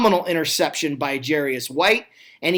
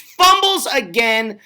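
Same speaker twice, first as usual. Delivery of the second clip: higher, slowed, stretched fumble call; excited call; 5 semitones higher, 4 dB louder, slower than usual. Real, not acted. Commentator calling yet another fumble, excited. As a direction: lean hard on the fumble.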